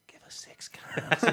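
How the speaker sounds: slow breathy whisper of the same demand; drunk whisper repeat. Retold or imitated. Drunk fella repeating his request to the librarian, whispered slowly. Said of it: "And he goes".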